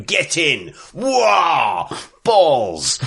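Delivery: hammed-up jubilant yells; mock cheering cries. Imitating the glowing manager speeding through the pack, hammed-up yells. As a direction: cry out like a giddy football manager.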